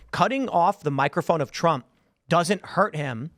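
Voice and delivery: ordinary talk, nothing marked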